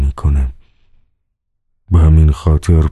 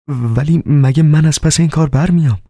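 high, startled disbelief; doubtful question; 10 semitones higher than usual. A narrator's reading. Margarita doubts she can grant the pardon, high and startled. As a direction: ask it as if it can't be true.

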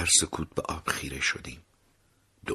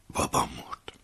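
stunned whisper; 6 semitones lower than usual. A narrator's reading. Narrator stunned by death.

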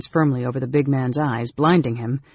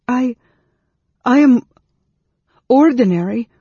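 put-on high, louder, halting girl; faltering; 10 semitones higher, 9 dB louder than usual. A narrator's reading. Frightened girl stammers to the mage gripping her, faltering. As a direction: falter on each word, pitched up. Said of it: "the girl faltered".

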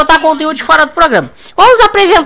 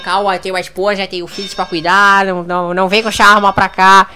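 lower, softer hammed-up plea; mock pleading; 6 semitones lower, 5 dB quieter than usual. Donovan begs Negão Jackson to put away the gun, mock pleading. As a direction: beg for your life, jokingly.

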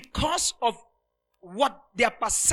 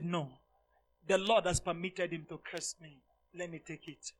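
calm refusal; 3 semitones lower, 8 dB quieter than usual. Retold or imitated. David calmly declines revenge.

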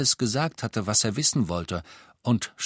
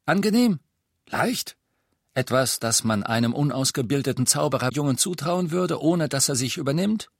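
höher, höhnisch fragend; Aberforth, spöttisch; 6 semitones higher, 3 dB louder than usual. Acted. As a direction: den Jungen verhöhnen. Aberforth mocking Harry's task. (spöttischer alter Wirt)

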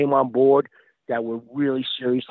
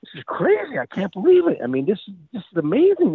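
amazed; 6 semitones higher than usual. Retold or imitated. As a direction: sound thrilled and amazed. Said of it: "And I'm like".

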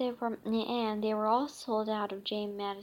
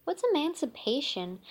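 put-on curious kid; 5 semitones higher, faster than usual. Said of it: "Judy asked".

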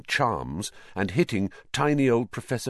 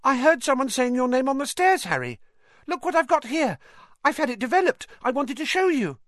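put-on much higher, loud piping boy voice; eager schoolboy fan; 4 dB louder than usual. A narrator's reading. Colin shows Harry his photograph, in an eager piping voice. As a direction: gush at your hero, high and excited.

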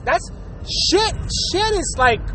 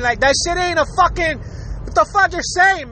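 normal talking voice

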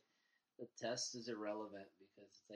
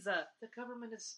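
higher mock-sincere voice; sarcastic praise, higher; 9 semitones higher, 5 dB louder than usual. Mocking government defenders sarcastically.

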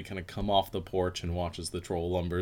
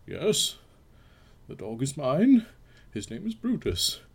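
lightly voiced gentleman; 8 semitones higher than usual. Sir Anthony answers troll.

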